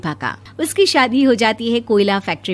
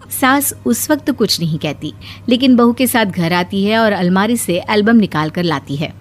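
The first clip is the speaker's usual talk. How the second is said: ordinary talk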